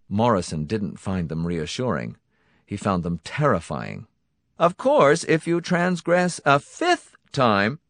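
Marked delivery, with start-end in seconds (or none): 4.6-7.8 high, slow, ominous tease; sinister Donati voice. Acted. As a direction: drag out the threat.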